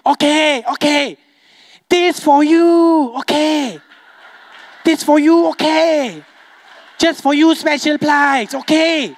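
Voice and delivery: high, drawn-out mock Chinese sales pitch; Chinese shopkeeper accent. Comic haggling with a customer in a shop, mock Chinese accent. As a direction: caricature a Chinese haggler, stretching the line endings.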